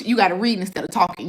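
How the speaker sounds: ordinary delivery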